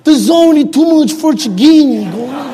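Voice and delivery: the quote again with Frocighine in an Italian lilt, half laughing; retelling the Pope's quote with his Italian word; mock Italian accent. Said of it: "That's the word he used".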